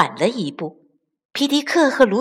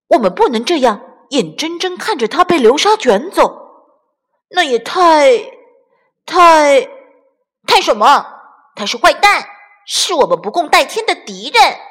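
heated argument; 5 semitones higher, 6 dB louder than usual. Acted. Chicks arguing over rescue.

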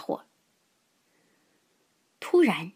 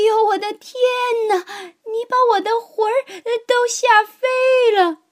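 put-on high, loud shriek of a startled old woman; old woman's frightened cry; 5 dB louder than usual. A narrator's reading. Old woman crying out at the lion's roar, high and loud. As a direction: scream as if your soul flew away. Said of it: "瘦小的老妇人喊起来".

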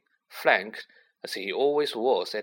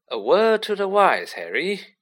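raised, mildly paternal counsel; 8 semitones higher, 6 dB louder than usual. A narrator's reading. Lockhart advising Harry, paternally. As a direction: advise like a self-assured father figure. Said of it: "said Lockhart paternally".

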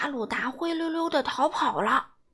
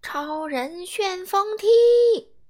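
slow, louder, drawn-out move call; hero's battle cry; 5 semitones higher, 6 dB louder, much slower than usual. Acted. Daddy Superman kicking the monster, slow battle cry. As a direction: call out the attack like a cartoon hero. Hero calls his special move.

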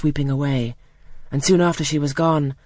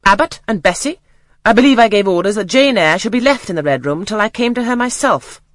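stern aunt; 6 semitones higher, 6 dB louder than usual. A narrator's reading. Stern aunt reprimands servants.

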